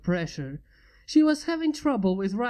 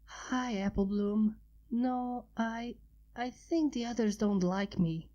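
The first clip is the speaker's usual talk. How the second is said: timid little dragon; 5 dB quieter, slower than usual. A narrator's reading. Sunrise voiced, timid and sad.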